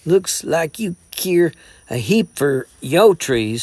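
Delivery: slow scornful sneer. A narrator's reading. Jason mocks Gray's trees, slow sneer. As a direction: drag out the contempt. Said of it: "sneered the mountain boy".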